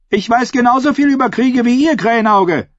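high, forceful retort; defiant retort. Acted. Ascher answers Krähenauge's taunt about war, high defiant retort. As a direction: throw it back at him in a high voice.

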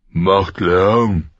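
a hint of a jailer's snarl; growl. A narrator's reading. Jailer growls at the noisy prisoner, mildly gruff. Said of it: "knurrte Mord".

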